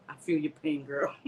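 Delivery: ordinary delivery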